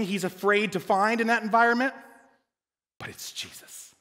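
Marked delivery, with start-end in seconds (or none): 3.0-3.9 hushed, breathy reveal of the name; whispered reveal. Acted. Preacher revealing it's Jesus, hushed whisper.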